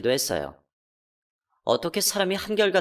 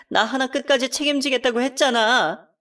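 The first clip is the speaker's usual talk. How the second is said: tearful accusation; 9 semitones higher, 4 dB louder than usual. Acted. Wife accuses cheating husband.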